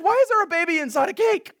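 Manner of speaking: high, loud, fast bewildered question; alarmed question. Retold or imitated. Pastor alarmed at the cake, fast and loud. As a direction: fire it off in alarm.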